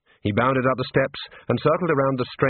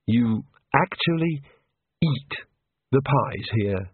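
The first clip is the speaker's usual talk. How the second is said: slow, halting disbelief with a touch of sorrow; mournful; slower than usual. A narrator's reading. Rincewind questioning pie eaters, mournful and halting. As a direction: pause heavily between words, mildly mournful. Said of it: "he said mournfully".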